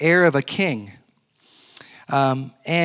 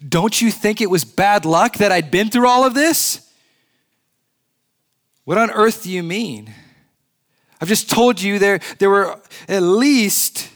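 put-on higher, louder, complaining boy's voice; aggrieved boy; 6 semitones higher, 4 dB louder than usual. Shasta to the lion, protesting his bad luck in a raised voice.

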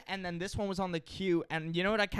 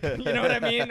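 ordinary talk, nothing marked

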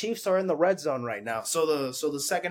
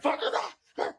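mock yell; faster than usual. Imitating pissed pitcher yelling in his glove, mock yell. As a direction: imitate a cursing pitcher at restrained volume. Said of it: "He was yelling in his glove".

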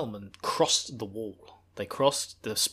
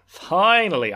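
relieved outburst; 6 semitones higher, 8 dB louder, slower than usual. Retold or imitated. Rand relieved to change clothes.

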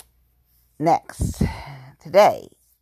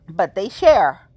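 emphatic shout; 3 semitones higher, 9 dB louder, slower than usual. Real, not acted. Hyping friends' shared beer.

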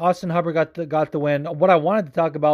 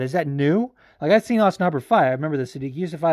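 ordinary delivery

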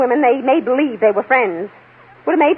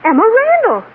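shocked surprise; 10 semitones higher than usual. Acted. Witness recognizes the wrong victim, high startled cry. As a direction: blurt out the real name in shock.

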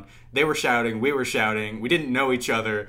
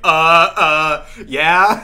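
loud, higher hesitant stammer; caught off guard; 5 semitones higher, 13 dB louder, much slower than usual. Retold or imitated. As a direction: act out fumbling for an answer. Startled reply to a stranger.

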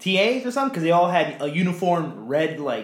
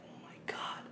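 breathy whispered oh my God; 21 dB quieter than usual. Retold or imitated. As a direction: whisper it in disbelief. Whispered reaction to hymn singing.

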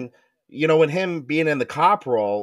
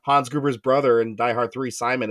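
usual speaking voice

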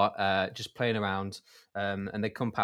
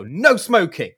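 much louder, higher snap; angry shout; 7 semitones higher, 11 dB louder, slower than usual. Retold or imitated. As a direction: bark it triumphantly. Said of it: "lobs the cigar".

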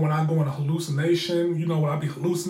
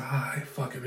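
resigned whisper; whispered resignation; 2 semitones lower, 6 dB quieter than usual. Retold or imitated. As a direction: whisper it, giving up. Resigned whisper in retold scene.

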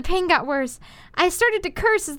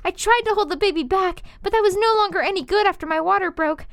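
ordinary delivery

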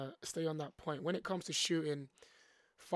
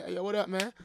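higher, louder acted-out hello; loud imitated greeting; 4 semitones higher, 8 dB louder, faster than usual. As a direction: call it out like a newcomer greeting players. Imitates an American player's greeting.

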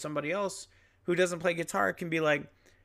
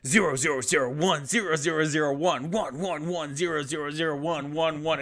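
robot saying binary; 2 semitones higher, 4 dB louder than usual. Shown as a demonstration. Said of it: "it sounds like fucking binary code".

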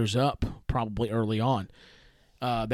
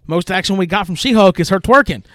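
loud mocking jab; 7 semitones higher, 11 dB louder than usual. Real, not acted. Host mocking She-Hulk's lack of action, loud and scornful. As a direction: voice jumps up high and loud on the punchline.

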